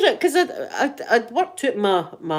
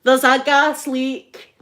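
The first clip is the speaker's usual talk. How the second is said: playful loud warning, much higher at the peak; mock gas alarm; 3 semitones higher, 6 dB louder than usual. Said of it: "Like that".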